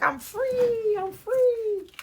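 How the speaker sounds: shrill, high, loud cry of release; high-pitched joyful cry, half laughing; squealed joy. Pretend. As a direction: squeal it out like a balloon set free. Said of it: "I don't know why I said it like that".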